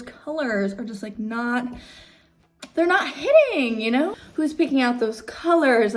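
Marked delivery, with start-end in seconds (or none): 2.7-5.9 exclaiming. Real, not acted. Exclaiming at ugly team colors.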